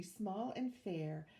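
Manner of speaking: ordinary delivery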